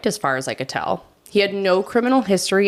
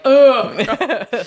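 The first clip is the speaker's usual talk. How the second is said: loud, higher mock groan of disgust; disgusted ugh; 6 semitones higher, 7 dB louder than usual. French listeners reacting to her pronunciation, loud mock ugh. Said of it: "Our French listeners are like".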